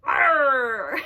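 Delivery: loud pirate arr; hammed-up pirate shout; pirate growl. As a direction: bark it like a pirate. Playing pirate for kids.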